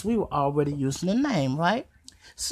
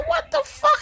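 much higher, stunned; seeing the toy fly, high shocked yelp; shocked squeal. Retold or imitated. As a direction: yelp it in disbelief.

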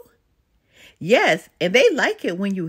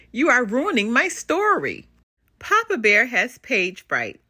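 plain reading voice

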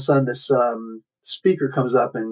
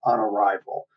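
ordinary talk

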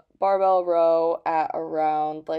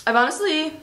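mouth full; 8 semitones higher, 6 dB louder, faster than usual. Real, not acted. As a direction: still chewing, mouth full. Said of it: "sorry, I have to my mouth full".

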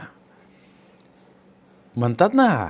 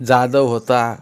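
ordinary delivery

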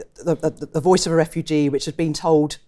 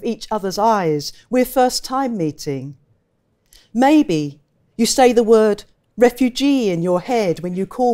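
higher, measured poetry reading voice; poem reading; 6 semitones higher, 5 dB louder than usual. Acted. Poet reading her refugee poem to the audience, higher and measured. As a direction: read it like a poem, deliberate and lifted.

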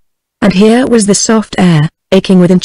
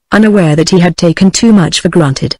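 usual speaking voice

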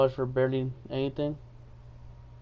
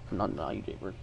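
normal talking voice

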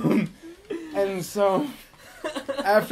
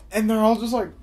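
usual speaking voice

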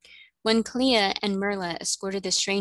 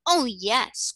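put-on higher, livelier child's exclamation; eager cry; 5 semitones higher, 4 dB louder than usual. A narrator's reading. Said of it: "cried Trot".

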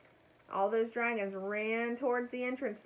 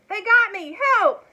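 high, very loud yell for help; crying out; 12 semitones higher, 16 dB louder than usual. A narrator's reading. Elmer pretends he is caught, crying out for help. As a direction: shout it as if just captured. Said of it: "shot off his pistol again and cried".

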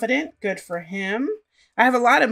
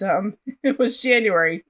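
ordinary talk

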